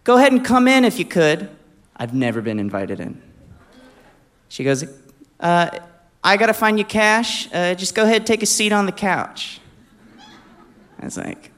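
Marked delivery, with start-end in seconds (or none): 0.0-1.5 high, loud call from another room; woman calling out. Retold or imitated. Woman invites her visitor inside, calling out high and loud. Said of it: "I just hear her voice". 5.4-9.6 high-pitched imitation of her calling; woman calling out. Woman tells her guest to take a seat, calling out high. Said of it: "I just hear her voice".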